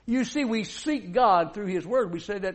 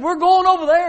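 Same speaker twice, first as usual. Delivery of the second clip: firm call; 6 semitones higher, 9 dB louder than usual. Acted. Jesus announces the crossing.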